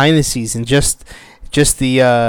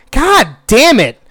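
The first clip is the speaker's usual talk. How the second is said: angry outburst; 9 semitones higher, 3 dB louder than usual. Real, not acted. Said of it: "it just makes me so mad".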